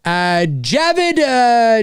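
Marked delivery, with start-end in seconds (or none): none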